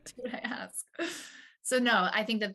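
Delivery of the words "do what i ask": soft, breath-only whisper. Retold or imitated. Mom joking about her rule for the kids, whispered. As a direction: whisper it as a playful repeat after a laugh. Whispered repeat after laughing.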